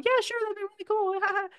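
very high, mock giddy girl with a nervous giggle. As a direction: squeak it out like a flustered girl who is flattered. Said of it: "giddy and nervous".

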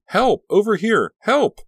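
very high, full-voiced screams for help; frantic calling at top volume; loud shriek. A narrator's reading. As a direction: shriek to be rescued. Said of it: "shrieked out as loudly".

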